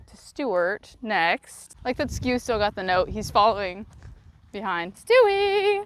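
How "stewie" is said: calling out. Real, not acted. Calling the horse over.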